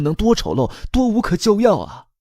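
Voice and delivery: high-pitched anguished scream. A narrator's reading. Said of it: "于梦兰尖叫起来". (高声尖叫)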